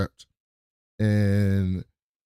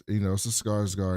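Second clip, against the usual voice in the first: ordinary delivery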